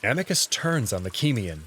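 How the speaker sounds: usual speaking voice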